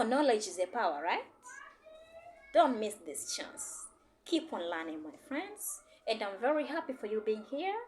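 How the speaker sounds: ordinary talk, nothing marked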